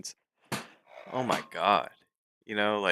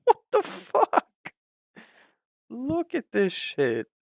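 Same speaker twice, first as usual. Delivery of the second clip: a slow squeal, far higher; disbelieving yell; 13 semitones higher, 3 dB louder, slower than usual. Real, not acted. Trader shrieking at his broken platform, slow disbelief.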